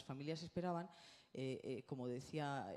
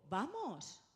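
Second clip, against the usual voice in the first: put-on "Vamos" said higher, as if to a baby; talking to a baby; 8 semitones higher than usual. Shown as a demonstration. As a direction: say "Vamos" to the child with clear lips. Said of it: "y le digo".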